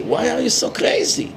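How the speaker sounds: high, whiny mock indignation; mocking lefty liberal. Mocking the lefty liberals who object to the death penalty, pitched high and whiny. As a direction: sneer it like an outraged liberal. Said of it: "The lefty liberals go crazy".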